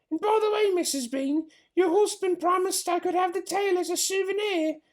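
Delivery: high put-on voice, eager for the fox's tail; a hearty maid asking for her prize; big servant woman. Mabel asks Missus Bean for the tail, high put-on voice.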